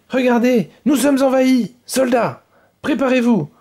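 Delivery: shrill rallying order; call to arms. Acted. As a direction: rouse the soldiers for war. Queen rallies soldiers to war.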